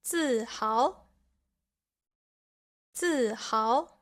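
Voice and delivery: falling 自, then rising 豪; second tone. Shown as a demonstration. Teacher giving Chinese examples, rising second tone on 豪 after falling 自. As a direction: say 自豪 dropping on 自 and climbing on 豪. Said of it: "the second character has the second tone".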